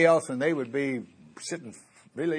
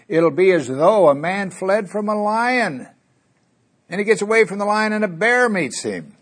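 higher, slowed storytelling reading; scripture reading; 7 semitones higher than usual. Acted. Preacher reading of a man fleeing a lion, raised pitch. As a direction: lift and stretch the prophet's image.

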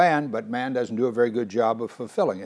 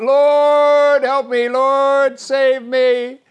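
much higher and louder, slow anguished plea; desperate cry; 13 semitones higher, 10 dB louder, much slower than usual. Pretend. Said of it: "you cry out".

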